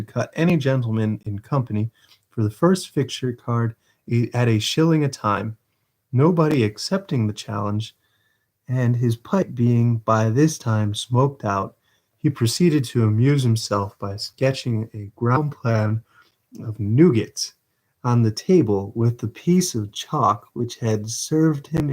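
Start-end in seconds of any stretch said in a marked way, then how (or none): none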